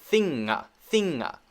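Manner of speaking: "thinga" with an extra uh after the ng; wrong added vowel. Shown as a demonstration. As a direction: deliberately tack an uh onto "thinga". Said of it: "you can hear that".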